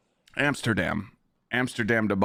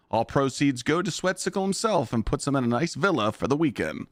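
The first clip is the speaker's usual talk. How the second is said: higher, bright sponsor pitch, promo-style; ad read; 6 semitones higher than usual. Acted. Streamer jokingly reads a fake ad for his friend, in a higher promo-announcer voice. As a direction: sell it like a hyped ad announcer. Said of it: "I wasn't even trying there and I made it sound good".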